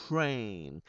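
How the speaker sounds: "train" softly, very slow, long ay; same ay diphthong. Shown as a demonstration. Teacher giving another example word, slow soft diphthong. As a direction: draw out "train" with e gliding into y. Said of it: "cũng vậy".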